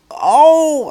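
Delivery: high loud cry of pain. Acted. Boy cries as ears are pulled, high loud yelp. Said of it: "Eric cried".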